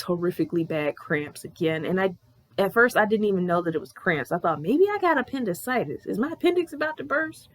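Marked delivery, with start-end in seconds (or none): 4.6-7.5 high-pitched retold worry; mock-worried high question; worried self-questioning. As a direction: voice the anxious thought up high. Voicing her worried past thought.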